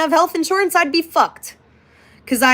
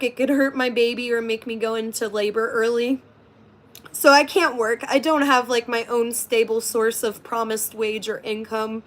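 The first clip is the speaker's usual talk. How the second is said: usual speaking voice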